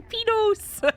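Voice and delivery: "Penos" said high as "PEE-nos", mocking; sing-song "Penos" up high like a tease; teasing "penos". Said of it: "he keeps going".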